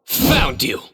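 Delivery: spring it on them; triumphant. Acted. Catches the culprit.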